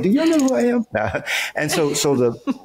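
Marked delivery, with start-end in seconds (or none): 0.0-0.8 high-pitched, playful mock diva; mock indignation. Joking about being refused a restaurant table, mock indignant. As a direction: squeak it out in joking self-importance. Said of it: "so I was like".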